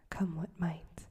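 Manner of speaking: plain reading voice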